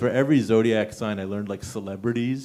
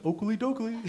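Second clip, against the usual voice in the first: much higher, lightly voiced Flanders chirp; Ned Flanders; 10 semitones higher, 5 dB quieter than usual. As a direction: chirp it like a cheery neighbor. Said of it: "my Ned Flanders energy".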